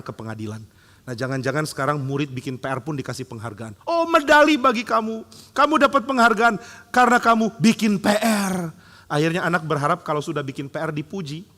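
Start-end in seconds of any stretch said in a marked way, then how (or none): 3.9-8.7 high, loud, over-the-top gushing; a teacher gushing over a student's homework, mockingly high and loud; mock gushing praise. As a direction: lay on the praise like a sarcastic teacher.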